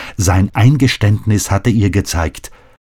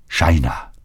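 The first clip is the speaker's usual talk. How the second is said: broken plea. Acted. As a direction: breathe her name like a lightly voiced plea. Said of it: "flehte er gebrochen".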